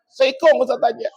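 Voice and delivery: high-pitched, mildly weepy imitation; mock crying. Husband tells the ustaz of his one child, mock tearful. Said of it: "Nangis jua nang lalaki itu jua".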